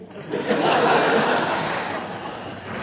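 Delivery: quick high laugh out of breath; high breathy laugh. Real, not acted. Telling the audience he hung up on Philips, high laugh. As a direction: laugh at your own cheek.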